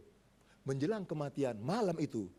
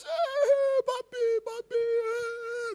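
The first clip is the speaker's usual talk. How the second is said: very high, slow wailing sob; sobbing child; 5 dB louder, much slower than usual. Retold or imitated. Preacher imitating grieving children rushing home to their dead father, sobbing. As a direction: wail for daddy through tears.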